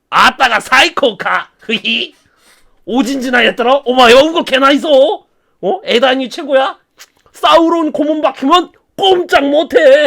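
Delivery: slow, slurred mocking voice; hammed-up Sauron mumbling threats; gibberish villain. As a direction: taunt in a mangled dialect.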